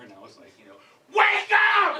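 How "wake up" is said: high, loud yell, not the whisper promised; comic shout instead of a whisper; shouted wake up. Retold or imitated. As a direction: blast it at her ear. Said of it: "I just gently whisper in her ear".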